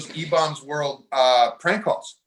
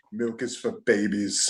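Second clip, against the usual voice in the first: Arnold impression; 6 semitones lower, 9 dB quieter, slower than usual. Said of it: "if you have other impressions".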